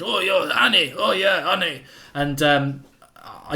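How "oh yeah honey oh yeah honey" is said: put-on lightly done Italian accent, a bit higher; a hint of a cartoon Italian crooning honey; Italian accent, mildly put on. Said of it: "he had, like, an Italian accent".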